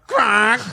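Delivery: taunting quack. Retold or imitated. Co-host guessing at the taunt, imitated quack. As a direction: imitate a silly taunting squawk. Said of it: "taunting him by going".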